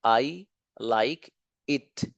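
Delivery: each word drawn out slowly for the class; slow model sentence. Shown as a demonstration. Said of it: "teacher models sentence slowly". (बहुत धीरे, एक-एक शब्द साफ़ बोलते हुए)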